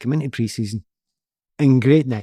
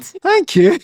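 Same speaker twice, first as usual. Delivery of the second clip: much higher, louder, laughing through it; high laughing thank you to the burglar; 7 dB louder than usual. Retold or imitated. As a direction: squeak out the thanks mid-laugh.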